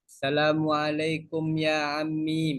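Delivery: slow, careful textbook Arabic greeting; formal Arabic greeting. Shown as a demonstration. Teacher shows students how a taxi greeting sounds. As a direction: spell out the polite fusha words slowly. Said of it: "nanti kita bilang".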